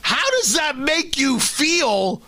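incredulous outburst building to a yell; outraged disbelief. Real, not acted. Outraged host rant.

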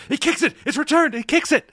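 high, loud mock commentator shout; excited Spanish-speaking commentator. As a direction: imitate a wildly enthusiastic sports announcer with a Spanish accent. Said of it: "the native Spanish speaker who's commentating".

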